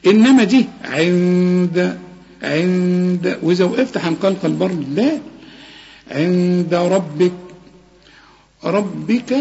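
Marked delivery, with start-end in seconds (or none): 6.1-7.3 ربك with a thick R and light B, slowly; heavy R. Shown as a demonstration. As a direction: recite عند ربك slowly. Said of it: "الراء هي المفخمة".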